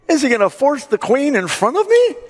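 high, loud, incredulous outrage; really bothered. Acted. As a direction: act out the king exploding in indignation. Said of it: "now he's really bothered".

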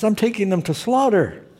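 put-on high-pitched Israeli accent. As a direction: imitate an Israeli man, pitched well up. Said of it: "Israeli accent".

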